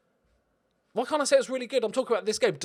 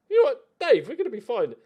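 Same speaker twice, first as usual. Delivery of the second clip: put-on camp German accent; 9 semitones higher, 6 dB louder, faster than usual. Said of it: "turning to each other and going".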